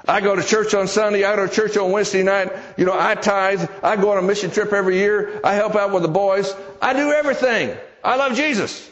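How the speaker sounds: high-pitched, indignant self-defence, acted out; mad protest. Bob defending his faith to his sister, mad protest. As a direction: rattle off your proofs, rising to an exasperated peak. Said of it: "He's really mad".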